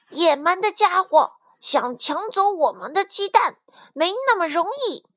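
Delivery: put-on high, indignant young fighter; indignant anger. A narrator's reading. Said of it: "愤怒地冲了出去". (愤愤不平地提高嗓门)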